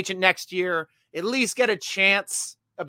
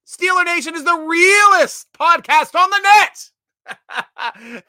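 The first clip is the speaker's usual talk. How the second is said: loud, high, stretched-out announcement; hyped-up proclamation; 10 semitones higher, 7 dB louder, much slower than usual. Acted. Host reading a fan's praise aloud, hyped-up proclamation. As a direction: belt it out slowly, leaning on each big word. Hypes up a viewer's comment.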